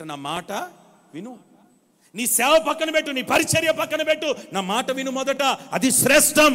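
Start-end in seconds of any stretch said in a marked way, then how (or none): 2.1-6.5 higher, louder urgent preaching; preaching at full force. Acted. Preacher telling the church to put obedience first, forceful preaching. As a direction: press the point on the listeners.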